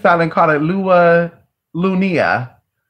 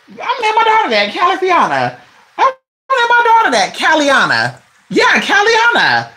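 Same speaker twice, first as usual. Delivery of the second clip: much higher, fast, delighted; excited squeal; 10 semitones higher than usual. Real, not acted. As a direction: squeal with delight over the name. Thrilled by the baby name idea.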